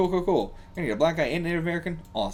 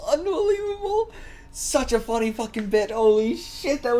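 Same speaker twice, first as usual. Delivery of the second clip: very high cracking laugh-word; real laughing squeal; laughing disbelief; 10 semitones higher than usual. Real, not acted.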